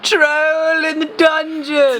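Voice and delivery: slow, high, drawn-out panicked yell; panicked shout. Acted. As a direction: cry the alarm at the top of your lungs. Quoting the Harry Potter panic cry.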